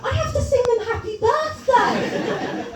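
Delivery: high, loud frantic inner rant; exasperated inner thought. Retold or imitated. Stuck wizard entertainer wishing it was a comedy gig, high and frantic. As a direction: spill out the panic in a rush. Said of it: "I'm thinking".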